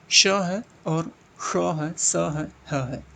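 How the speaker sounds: each sibilant drawn out slowly, श and स hissed clearly; teacher spells out श, स, ह slowly for students; slow sibilant demo. Shown as a demonstration. Teacher demonstrating sibilants to class, slow and drawn out. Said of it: "ऊष्म वाले क्या?".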